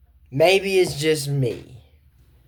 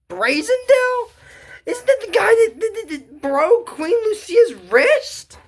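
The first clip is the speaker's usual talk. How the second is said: much higher, near-crying shock as it sinks in; shocked disbelief; 14 semitones higher than usual. Real, not acted. Reacting to the kingdom's dark past, shocked and much higher. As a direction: blurt it out, aghast at the twist.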